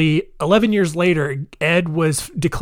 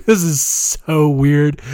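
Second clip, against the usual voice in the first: laughing; slower than usual. Real, not acted. Giggling over grisly closet find.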